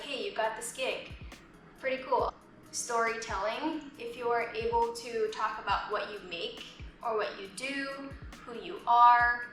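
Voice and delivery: normal talking voice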